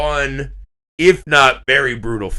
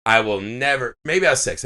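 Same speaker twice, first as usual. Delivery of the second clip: normal talking voice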